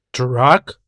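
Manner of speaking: usual speaking voice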